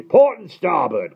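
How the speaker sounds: high, loud, slow bellow; blustering admiral. A narrator's reading. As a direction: roar it like an old salt. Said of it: "cried the Admiral".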